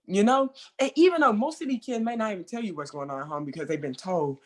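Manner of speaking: usual speaking voice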